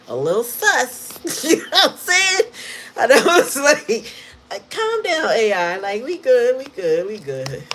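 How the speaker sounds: high, loud giggling; laughing. Real, not acted. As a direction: cackle through it. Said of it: "I'm so tickled right now".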